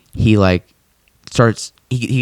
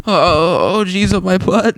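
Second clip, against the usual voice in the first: Morty voice; 9 semitones higher, slower than usual. Retold or imitated. Retelling Morty's voice.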